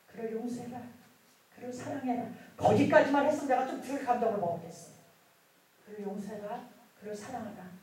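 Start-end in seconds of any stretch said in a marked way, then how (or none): 0.1-2.3 softer, quiet divine command; voice of the Lord. Retold or imitated. God tells the believer to forgive, quiet voice of the Lord. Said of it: "항상 우리에게 하시는 말씀". 5.9-7.7 softer, quiet divine command; voice of the Lord. Retold or imitated. God tells the believer to forgive, quiet voice of the Lord. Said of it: "항상 우리에게 하시는 말씀".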